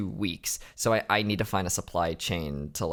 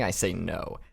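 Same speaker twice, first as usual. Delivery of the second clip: normal talking voice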